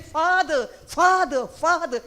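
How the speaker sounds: mock scream, each call falling; retold child's scream. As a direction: imitate a screaming child, pitched well up. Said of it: "But he's screaming".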